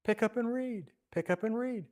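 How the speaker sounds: put-on high, lilting child's chant; child's sing-song voice. Child calling to Augustine in the garden, high sing-song. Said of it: "kind of in a sing-song voice".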